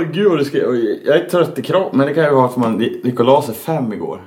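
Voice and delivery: ordinary delivery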